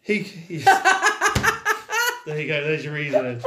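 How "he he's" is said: high laugh breaking into words. Real, not acted. Laughing about the character.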